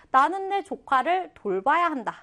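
ordinary delivery

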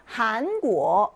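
한국어 slowly, each syllable rising; two rising tones. Shown as a demonstration. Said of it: "이 성, 이 성 올라가요".

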